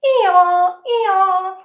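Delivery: high, loud hee-haw bray; donkey bray. Shown as a demonstration. Reader leading children in a bray, loud donkey call. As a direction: bray along with the kids. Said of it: "Can we say that together?".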